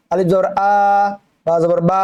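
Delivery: আ and বা held long; wrong demo with drawn-out endings on আ and বা; wrongly stretched vowel. Shown as a demonstration. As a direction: drag out the final আ and বা as a mistake. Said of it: "এভাবে না, কোনো টান হবে না".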